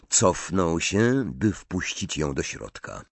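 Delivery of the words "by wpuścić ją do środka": usual speaking voice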